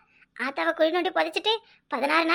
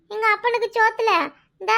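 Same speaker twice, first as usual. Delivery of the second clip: ordinary delivery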